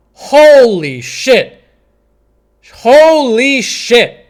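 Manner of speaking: very slow, drawn-out astonishment; amazed relief. Real, not acted. Streamer relieved he actually followed through, drawn-out amazement. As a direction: stretch each word out in awe. Amazed the move was finally played.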